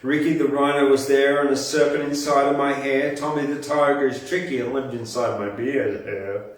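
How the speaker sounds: ordinary talk, nothing marked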